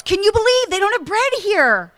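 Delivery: loud high mock scream in the aisles; a hammed-up yell about missing bread. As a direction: rant at the shoppers, hammed up. Acting out her own outburst in aisles.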